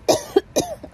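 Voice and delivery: sharp dry cough; coughing from dust in the lungs. Real, not acted. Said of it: "dust in my lungs".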